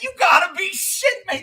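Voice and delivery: shocked, angry cry of disbelief; outraged disbelief. Retold or imitated. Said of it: "I was like".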